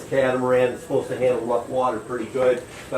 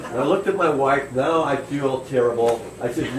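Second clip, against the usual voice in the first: ordinary talk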